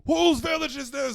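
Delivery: loud, high bellow; hammed-up battle yell; mock Viking shout. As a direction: roar it like a raider. Acting out Viking raiders.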